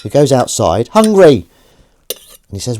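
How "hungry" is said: high, loud, insistent call; louder still. Acted. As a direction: call out loudly from outside, pitched high. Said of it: "and it's louder".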